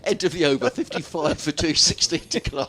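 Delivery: laughing score update. Real, not acted. Commentator reading the score after banter, laughing. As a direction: keep announcing despite the giggles.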